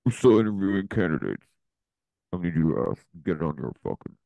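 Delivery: a hint of a barking boss; bossy chef voice. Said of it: "Chef Penny goes".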